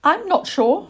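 high little-owl voice, unsure; young owl Plop, doubtful. Acted. Little owl's doubtful reply.

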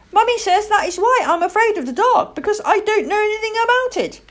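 high, loud little chick voice; earnest young owl. Acted. Owl chick confiding his fear to a girl, earnest. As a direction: explain earnestly in a high child's voice.

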